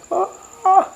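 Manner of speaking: mild high-pitched mock scream; imitated distant scream. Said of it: "agak jauh sih, teriak".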